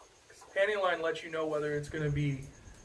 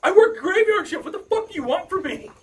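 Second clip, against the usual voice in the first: much higher, louder exasperated rant with a laugh; retelling his fed-up yell at his girlfriend; retold outburst; 9 semitones higher, 4 dB louder than usual. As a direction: vent at her, half laughing. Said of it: "I was all like".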